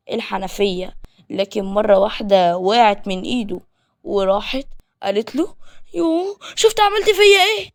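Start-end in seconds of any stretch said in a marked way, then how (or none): 5.9-7.7 high, loud whining complaint; girl scolding Adam after being dropped; whiny girl. Acted. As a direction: wail it like a spoiled girl, much higher. Girl dropped under the tap.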